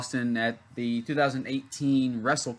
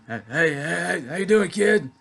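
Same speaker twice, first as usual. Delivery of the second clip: higher, louder gruff star greeting; imitating Austin; 5 semitones higher, 5 dB louder than usual. Wrestler greets the fan, mock impression. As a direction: do a quick take on the wrestler saying hi.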